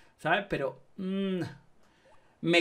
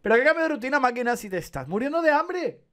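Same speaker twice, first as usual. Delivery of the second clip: indignant retort; 8 semitones higher than usual. Real, not acted. Scolding the game character.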